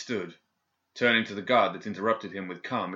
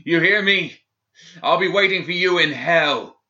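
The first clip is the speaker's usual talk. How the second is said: screaming; 10 semitones higher, 12 dB louder than usual. Acted. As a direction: scream the curse from the cell. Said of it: "Gideon screamed".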